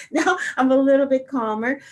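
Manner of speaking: high, loud laugh spilling into the words; amused, laughter-lifted voice. Real, not acted. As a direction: laugh at yourself while talking. Laughs at own hyper squeal.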